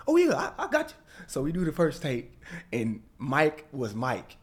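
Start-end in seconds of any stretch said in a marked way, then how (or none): 0.1-1.0 much higher, louder and laughing; excited agreement. Retold or imitated. Replaying Irvin agreeing to the video, laughing. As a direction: squeal it out with a laugh.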